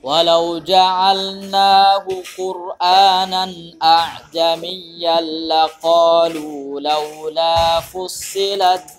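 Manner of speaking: slow, strong tajwid chant; sustained melodic Quranic recital; Quran recitation. Shown as a demonstration. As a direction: recite with long held vowels, loud and steady.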